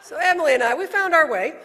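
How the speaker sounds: words bursting out through a laugh. Real, not acted. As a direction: still laughing, loud and high. Laughing after punchline.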